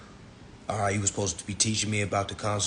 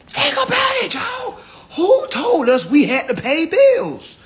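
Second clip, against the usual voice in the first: shouted challenge; 8 dB louder, slower than usual. Real, not acted. Rallying his listeners to question bills.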